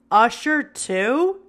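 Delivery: slow, appalled question; revulsion. A narrator's reading. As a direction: recoil at the thought, drawn out. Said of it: "Kimura said with revulsion".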